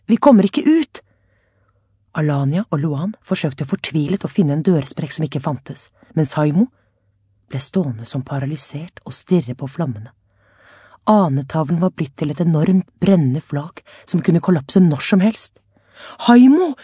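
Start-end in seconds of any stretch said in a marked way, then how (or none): 0.1-1.0 panicked cry. A narrator's reading. Trapped in burning room. 16.3-16.7 high, loud call of his name. A narrator's reading. Alania calling frozen Haimo, loud urgent call. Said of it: "ropte Alania".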